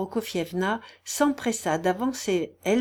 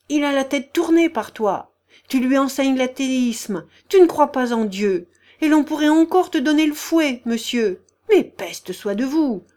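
loud, high-pitched scolding; indignant ranting woman; 6 semitones higher, 8 dB louder than usual. A narrator's reading. The general's wife scolding boy and prince, indignant. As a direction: demand answers in outrage.

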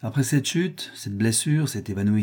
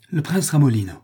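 higher-pitched outburst, falling at the end; exclaiming; 4 semitones higher, 4 dB louder than usual. A narrator's reading. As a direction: exclaim it as a sudden realisation. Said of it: "s'écria le chevalier".

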